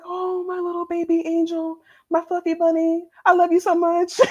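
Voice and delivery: put-on high, cooing baby talk to a grown son; doting mom voice. Imitating a boy mom cooing over her son in high baby talk. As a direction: gush over him like he's still a toddler. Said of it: "very sweet, flowery language".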